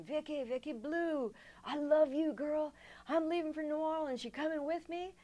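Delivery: high, loud call from outside, full of love. Retold or imitated. Husband calling to her from the alley, high loud call. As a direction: shout it up the alley like a lovesick man.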